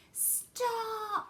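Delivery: high, drawn-out acted yell. A narrator's reading. Grandma Josephine yells over the wind, high and alarmed. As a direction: cry out for it to stop. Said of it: "yelled Grandma Josephine".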